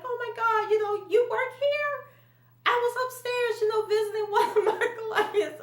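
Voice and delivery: high, sing-song fake surprise; mock surprised gush. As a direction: put on a sugary, shocked greeting. Coworker acts surprised meeting Lawrence.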